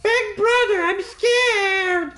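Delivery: put-on very high, frightened girl's voice; scared little sister. Yuka telling her big brother she is scared, very high.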